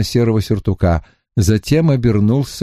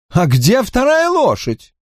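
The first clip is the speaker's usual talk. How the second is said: high, impatient complaining voice; fretful Drawlight; 12 semitones higher than usual. A narrator's reading. Drawlight complaining to Lascelles, higher fretful voice. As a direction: whine at the late rider.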